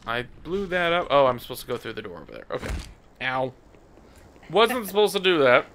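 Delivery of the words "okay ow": ordinary delivery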